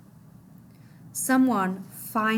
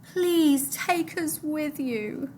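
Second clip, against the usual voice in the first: high, very slow plea; high slow begging boy; pleading boy; 6 semitones higher, much slower than usual. Acted. Mahmoud pleading.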